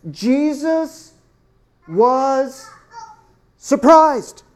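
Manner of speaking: Jesus, was, surprised, each word set apart with pauses; slow emphatic reading. Shown as a demonstration. Preacher spelling out the verse to the congregation, slow and emphatic. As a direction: read it slowly, one word at a time, landing on surprised. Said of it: "And notice Luke says".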